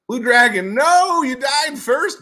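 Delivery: loud, high, slowed exclamation; excited correction. Real, not acted. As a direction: react to the surprise result. Excited reaction to game result.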